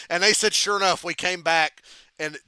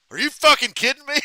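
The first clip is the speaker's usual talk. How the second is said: mock yell, much higher and louder; outraged shout; 12 semitones higher, 12 dB louder than usual. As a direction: blow up in disbelief. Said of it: "Shawn was like".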